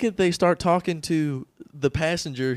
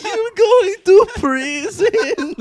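high, loud, hugely stretched words; mock wailing shout; 11 dB louder, much slower than usual. Cop reversing his promise to suspect, mock wailing shout. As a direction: howl it out for comic effect. Acted cop's comic reversal.

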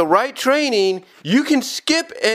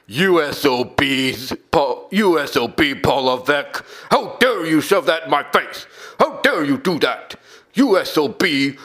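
imagined bitter rant; 4 semitones lower than usual. Retold or imitated. Commentator voicing a rival boss's secret anger, low and seething. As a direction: growl out a hidden grudge. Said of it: "deep down he's like".

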